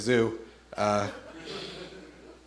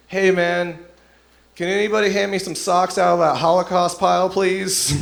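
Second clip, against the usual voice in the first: raised, higher-pitched call across a room; 6 semitones higher, 3 dB louder than usual. Acted. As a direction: call out a casual request, pitched up. Bunkmate calls into the laundry room.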